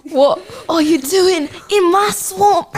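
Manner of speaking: loud, high, drawn-out ogre shout; Shrek. Acted. Giving friends a line from Shrek, loud put-on ogre outrage. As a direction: bellow slowly at intruders as the ogre. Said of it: "give me a sentence from Shrek".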